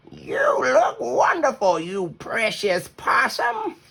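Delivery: high, slow, doting voice; admiring grandma. Acted. Grandma Poss admires visible Hush, slow high doting voice. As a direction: fuss over her lovingly.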